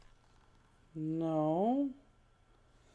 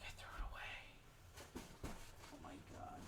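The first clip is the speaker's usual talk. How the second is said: gasp, then whisper in dismay; hushed, horrified whisper to yourself; stunned whisper; 22 dB quieter, much faster than usual. Real, not acted. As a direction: whisper it as the awful realization sinks in. Realizes piece may be lost.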